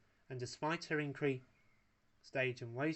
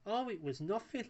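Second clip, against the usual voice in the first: laughing; 8 semitones higher than usual. A narrator's reading. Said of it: "laughed Wallace".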